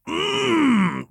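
high, sustained pleased hum. A narrator's reading. Lucian responds to the lover, humming. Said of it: "Lucian hummed".

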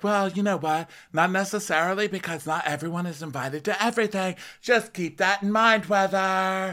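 high, pointed imitation of Lisa; hammed-up know-it-all advice; mock Lisa lecture. Host imitating Lisa lecturing Heather, high and pointed.

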